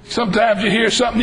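ordinary delivery

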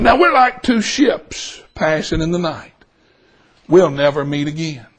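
low, softened, solemn goodbye; tender farewell. Retold or imitated. Customer says goodbye to waitress for good, tenderly solemn. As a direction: let it sink low and gentle.

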